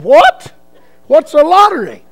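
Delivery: loud, high startled what; shocked. Retold or imitated. Preacher stunned to learn they are lottery tickets, loud and high. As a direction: blurt it out in disbelief.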